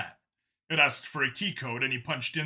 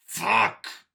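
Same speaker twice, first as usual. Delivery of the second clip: loud, high bitten-off curse; frustrated curse; 7 semitones higher, 6 dB louder than usual. A narrator's reading. Vincent cursing his doubts, loud frustration. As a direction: spit it out in frustration. Inner outburst.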